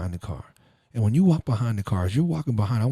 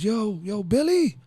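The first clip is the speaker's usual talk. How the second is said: gangster yelling. Retold or imitated. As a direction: holler for the money at the kid across the street. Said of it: "He goes".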